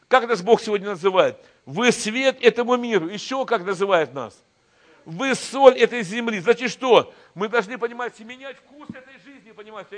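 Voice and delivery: loud, high, fiery sermon voice; preaching at full force. Acted. Pastor rallying his church as God's army, preaching loud. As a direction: rouse the church like a revival preacher.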